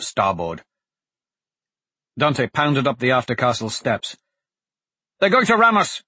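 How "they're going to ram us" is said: loud panicked warning; alarmed shout. Acted. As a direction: yell it in alarm. Ship about to ram them.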